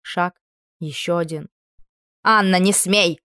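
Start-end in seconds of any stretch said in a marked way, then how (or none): 2.2-3.2 loud shout of warning. A narrator's reading. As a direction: call it out sharply to stop her. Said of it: "выкрикнул лорд Уэстерби".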